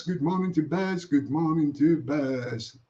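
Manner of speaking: dropped low and slowed right down. Acted. Greeting repeated, high then low.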